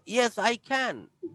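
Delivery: "Yes, I can" spoken very slowly with a stretched "can"; long drawn can. Shown as a demonstration. Teacher answering "can you sing": slow stretched "can". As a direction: stretch each word, leaning on "Yes". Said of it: "একটু লম্বা হচ্ছে উচ্চারণটা".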